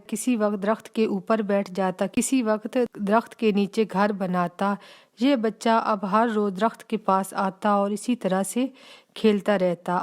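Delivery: plain reading voice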